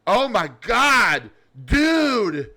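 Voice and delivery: high, loud, slow stunned exclamation; stoned monkey. Acted. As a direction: gape in amazement, stretched out. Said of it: "The monkey looks down and says".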